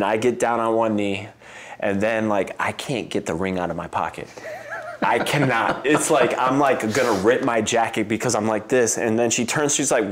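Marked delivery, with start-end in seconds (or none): none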